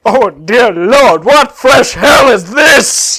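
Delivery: high, slow shouted villain wail; loud drawn-out theatrical outrage; horrified Cell. Acted. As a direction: cry out in dramatic horror. Cell reacts to Broly.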